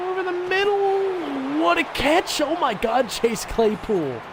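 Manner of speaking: high, drawn-out cry of amazement; amazed call. Real, not acted. Gamer calls his receiver's catch in amazement. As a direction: call the catch like you can't believe it.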